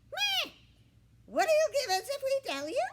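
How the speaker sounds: very high, loud, fast hen squawk; squawky hen. A narrator's reading. Scruffy hen haggles with the pig, squawking high.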